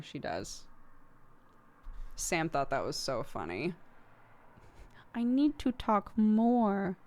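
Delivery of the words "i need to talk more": put-on higher, slow childlike voice; lightly voiced little girl, slowly; imitating toddler. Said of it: "She even says I need to talk more".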